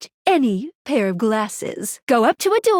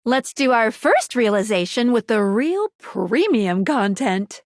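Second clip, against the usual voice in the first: usual speaking voice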